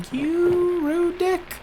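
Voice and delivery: high, very slow put-on voice; mock insult. Player mocking the character, high and drawn-out. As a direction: stretch it out in a silly squeaky voice.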